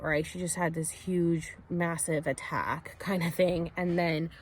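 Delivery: normal talking voice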